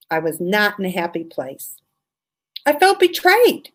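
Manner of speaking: ordinary talk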